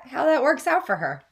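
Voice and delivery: plain reading voice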